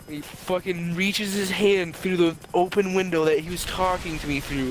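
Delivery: ordinary delivery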